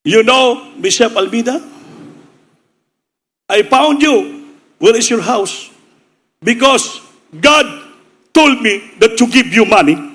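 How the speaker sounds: high, slow, earnest voice of a foreign visitor; high and slow, as the visiting American; American benefactor. Retold or imitated. American stranger gives the pastor money.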